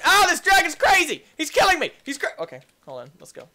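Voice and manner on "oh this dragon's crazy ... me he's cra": high, loud alarmed yelling; frantic gamer shout; panicked shout. Real, not acted. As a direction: yell as the ride runs wild. Dragon out of control.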